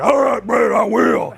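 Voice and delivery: loud, high hammed-up bro voice; mock one of the bros. Host mimics a contestant eager to obey the star, loud bro voice. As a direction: bellow agreement like an eager bro. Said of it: "like one of the bros".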